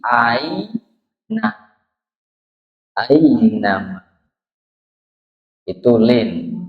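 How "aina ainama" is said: "aina, ainama" drawn out, a gliding into i; soft glide to i. Shown as a demonstration. Teacher correcting ai to i, drawn-out "ainama". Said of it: "tapi i".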